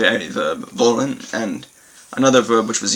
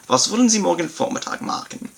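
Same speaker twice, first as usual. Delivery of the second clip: higher German repeat; 7 semitones higher than usual. Shown as a demonstration. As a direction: lift the whole sentence for emphasis. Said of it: "for better emphasis on this concept".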